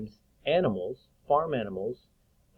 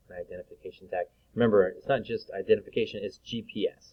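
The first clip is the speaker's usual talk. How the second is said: ordinary talk, nothing marked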